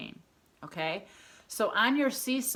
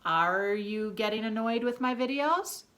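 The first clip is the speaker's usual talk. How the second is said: "Are you getting annoyed with my videos" climbing steadily to a high end on "videos"; questioning tune; 3 semitones higher, 4 dB louder than usual. Shown as a demonstration. Teacher demonstrating a question mark, rising to "videos". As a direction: ask it with pitch rising all the way to "videos". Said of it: "This is if we are asking".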